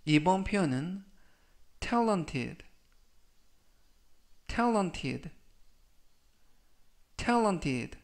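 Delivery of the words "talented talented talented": "talented" said slowly and clearly, stress on the first syllable, falling; careful pronunciation demo. Shown as a demonstration. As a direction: model "talented" like a teacher, syllable by syllable. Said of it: "라고 발음하시면 되겠고요".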